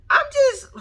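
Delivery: exasperated squeak. Real, not acted. Exasperated outburst.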